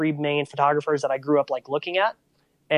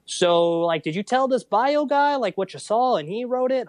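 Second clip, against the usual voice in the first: skeptical questioning; 7 semitones higher than usual. Retold or imitated. Mom doubts he wrote it.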